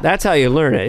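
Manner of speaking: usual speaking voice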